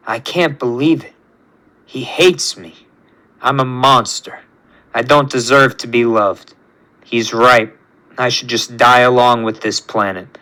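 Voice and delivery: low, soft, defeated self-loathing; dejected despair. A narrator's reading. Rejected creation facing the planet's end, low and dejected. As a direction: sink into quiet hopelessness.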